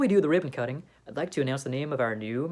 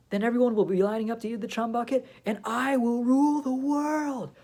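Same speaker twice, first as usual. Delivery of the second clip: megalomaniac rant; 6 semitones higher than usual. Acted. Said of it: "Plankton plans to rule".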